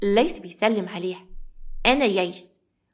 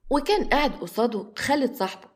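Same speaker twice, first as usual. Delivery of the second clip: usual speaking voice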